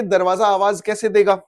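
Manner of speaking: normal talking voice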